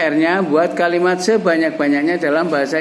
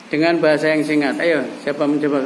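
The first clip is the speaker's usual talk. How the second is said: ordinary delivery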